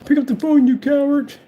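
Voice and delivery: high, loud mock yell of the troll; high-pitched mocking impression of the hater; mocking quote. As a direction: shriek it like the angry texter. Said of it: "He be saying".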